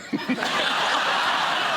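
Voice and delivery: short airy laugh; breathy laughter. Real, not acted. Guest laughing about the fur bikini, airy laugh. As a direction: snort out a laugh.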